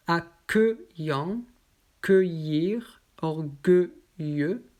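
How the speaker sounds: "Accueillant" drawn out slowly; slow drill. Shown as a demonstration. Teacher slowly reading the euil words to students. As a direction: stretch "orgueilleux" syllable by syllable. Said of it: "motif E U I L".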